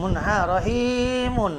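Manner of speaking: রহিমুন said slowly with রহি held; long drawn rahi. Shown as a demonstration. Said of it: "রহিটা টান দিতে হবে". (রহি টেনে রহিমুন)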